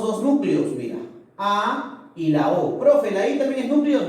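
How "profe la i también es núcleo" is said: higher, lightly pushed pupil's question; doubting student. Acted. Student asks teacher if the I is a nucleus, higher acted voice. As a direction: pipe up as a student querying the teacher.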